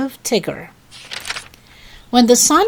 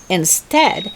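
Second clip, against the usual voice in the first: usual speaking voice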